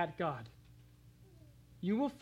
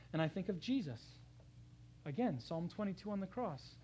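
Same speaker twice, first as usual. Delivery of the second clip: ordinary talk, nothing marked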